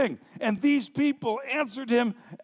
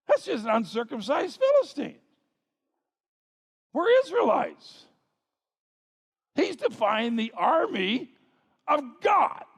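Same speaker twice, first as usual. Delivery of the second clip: fired-up disbelief, pitched up, punching the last words; rally shrinking men with rising outrage; indignant young David; 4 semitones higher than usual. Acted. Young David to frightened soldiers at the giant's insult, indignant.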